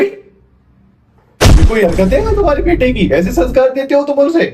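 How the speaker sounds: high, outraged teacher's complaint; indignant scolding. Acted. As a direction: scold the parent, pitch shooting up. Teacher scolds father over son's prank. (नाराज़गी भरी ऊँची आवाज़ में डाँट)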